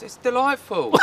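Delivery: "It's delightful" in a high, hammed-up British accent; buddy from London, mock British cheer. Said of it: "my buddy, who was from London, goes".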